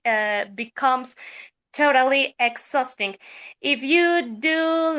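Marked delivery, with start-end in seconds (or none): none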